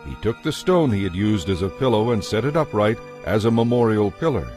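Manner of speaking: ordinary delivery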